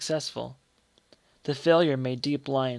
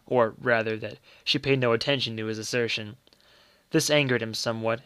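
plain reading voice

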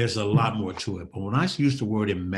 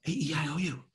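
quick, contained vowel run; hushed-edged rapid drill; low and fast; 4 semitones higher than usual. Shown as a demonstration. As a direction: run the letters together without shouting. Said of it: "low and fast".

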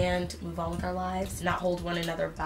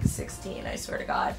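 much lower, gravely serious joke voice; mock deep voice; 4 dB quieter than usual. Warning young viewers about The Affair, mock deep voice. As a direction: drop the voice way down like a spooky warning.

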